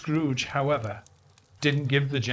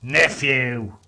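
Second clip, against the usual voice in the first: loud, stern cold address; 6 dB louder than usual. A narrator's reading. Scrooge coldly rebuffing his nephew, stern. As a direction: shut him down sternly. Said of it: "returned the uncle sternly".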